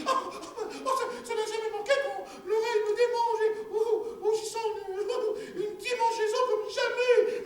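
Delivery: high, plaintive woman's voice, half crying; wife's high whining lament. Acted. Wife protesting to her husband, high and tearful. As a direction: whine and protest like a fretful peasant wife.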